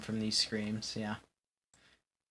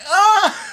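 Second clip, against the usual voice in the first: very high, loud hammed-up yell; 14 dB louder than usual. Acting out the hero's feeling, high loud mock scream. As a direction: belt out a cartoon scream. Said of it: "you feel like".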